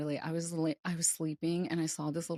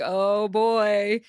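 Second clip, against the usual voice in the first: loud, drawn-out, higher groaning reaction; retold dismay; 4 semitones higher, 10 dB louder than usual. Guest finding little lizards in treehouse, comic dread. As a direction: draw it out big in comic dread.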